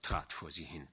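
plain reading voice

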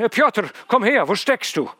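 loud call across the field. Acted. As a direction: shout for the servant. Doctor calls the servant.

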